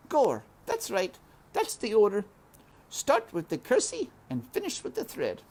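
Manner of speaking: high, nervous self-coaching; Mig muttering. A narrator's reading. Mig muttering at the door, high and nervous. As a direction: run through the steps anxiously. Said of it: "muttering to herself".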